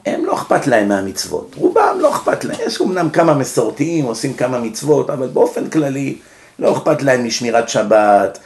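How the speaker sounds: ordinary talk, nothing marked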